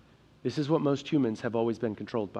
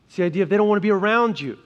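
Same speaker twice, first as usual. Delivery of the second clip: louder, higher, driving preacher's voice; full-force preaching; 6 semitones higher, 8 dB louder than usual. Acted. Pastor fires up the congregation about money, loud and impassioned. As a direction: build it up and push it to the back pew.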